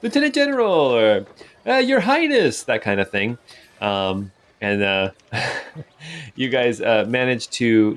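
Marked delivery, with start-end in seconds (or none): 0.0-2.6 higher, bright-eyed townsperson hailing the heroes; townsfolk greeting. Acted. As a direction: call out cheerfully from the crowd. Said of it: "People say, like".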